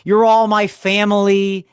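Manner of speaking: high, loud, drawn-out emcee impression. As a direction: imitate his slow stage shout to the crowd. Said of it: "he would say it just like that".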